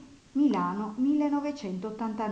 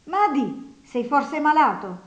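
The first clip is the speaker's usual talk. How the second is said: loud question; 5 semitones higher, 6 dB louder than usual. A narrator's reading. Nastasia questions the sleeping man.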